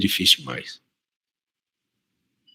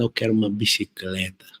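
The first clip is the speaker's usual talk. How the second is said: a hint of a bossy, big-voiced little girl; lightly voiced stern little daughter making her demand; imitating a gruff toddler; 2 semitones lower, 8 dB quieter than usual. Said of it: "Braba, vozona mesmo".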